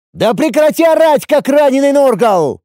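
very high, angry bellow back; ответный рёв. A narrator's reading. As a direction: roar back just as hard. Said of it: "меня взревел в ответ ошеломлённый". (взреветь в ответ)

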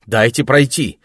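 firm. A narrator's reading. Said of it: "чтобы её собственный голос звучал жёстче".

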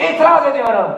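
hammed-up movie attorney; mock courtroom objection. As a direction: shout it like a film lawyer.

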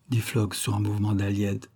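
ordinary delivery

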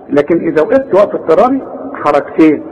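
ordinary talk

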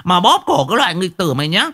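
high, fast tirade of curses; angry old village woman cursing a thief; ranting village woman. Acted. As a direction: scold relentlessly, pitched well above normal. Voices a scolding woman.

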